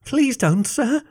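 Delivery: high-pitched puppyish pleading; whimpering. A narrator's reading. Fox begs Jason with puppy dog eyes, whimpering. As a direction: plead in a put-on little whimper. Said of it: "I whimpered".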